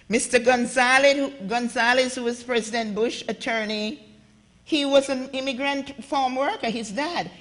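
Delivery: ordinary talk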